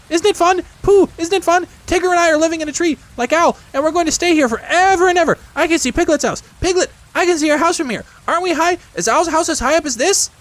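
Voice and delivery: put-on very high, loud excited child-creature; excited little Roo. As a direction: squeal it all out in delight.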